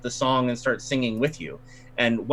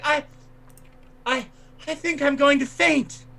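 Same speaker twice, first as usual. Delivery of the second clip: much higher, fluttery mock-fainting voice; swooning lady; 13 semitones higher than usual. Woman pretending to faint before the crowd, high and breathless. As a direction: stammer like you're about to swoon, hammed up. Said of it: "she's like".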